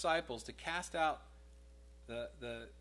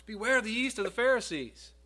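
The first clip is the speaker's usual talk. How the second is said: higher, louder "Beware the yeast of the Pharisees."; urgent warning; 9 semitones higher, 8 dB louder than usual. Shown as a demonstration. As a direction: cry it out as a warning to the flock. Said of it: "quote repeated for emphasis".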